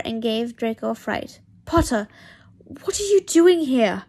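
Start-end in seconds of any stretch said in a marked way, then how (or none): 1.7-4.0 loud, high what are you doing here; frightened outburst at the intruder; startled. Acted. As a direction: Draco caught off guard, shouting at Potter.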